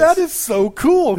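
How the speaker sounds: high and loud, stretched out; excited. Acted. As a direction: gush with delight. Reacting to the winner drawn.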